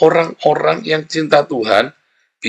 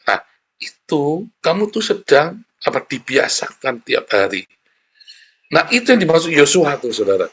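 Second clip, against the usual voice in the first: usual speaking voice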